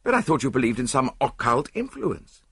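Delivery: high-pitched bewildered protest; astonished objection; stupefied. A narrator's reading. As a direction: blurt out puzzled disbelief. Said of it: "I cried, stupefied".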